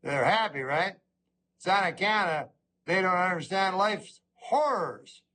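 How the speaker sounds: ordinary delivery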